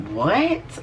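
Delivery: high rising incredulous what; mock disbelief. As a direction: act baffled, pitch shooting up. Acting indignant at the accusation.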